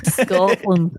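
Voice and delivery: Scotland as skɔtlən, a tentative Scottish go; a hint of Scottish accent; attempted Scottish. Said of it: "hear [name] try it again".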